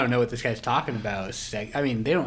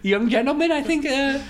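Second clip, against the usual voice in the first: higher, louder hammed-up posh English gent; posh English accent; 12 semitones higher, 5 dB louder than usual. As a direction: play the Monopoly tycoon in a plummy English accent. Monopoly man praises.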